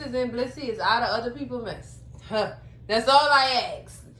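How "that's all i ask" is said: loud, slow and deliberate on all; emphatic. Real, not acted. Vlogger telling viewers her one rule, loud and slow.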